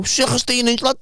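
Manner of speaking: imitating Kurdish kid. Mocking the kid's reply about pizza sizes, broken English accent. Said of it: "Kurdish kid".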